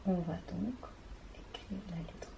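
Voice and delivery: voix chuchotée, très douce; chuchoté. Real, not acted. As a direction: murmurez en écrivant. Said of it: "chuchote en écrivant". (chuchotement de maîtresse)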